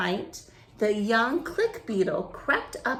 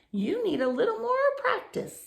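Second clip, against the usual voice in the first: higher squeaky little mouse; 13 semitones higher, faster than usual. Acted. Passing mouse encouraging the beetle, higher voice. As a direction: chirp it up high like a mouse.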